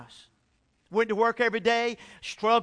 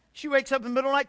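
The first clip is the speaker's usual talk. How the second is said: normal talking voice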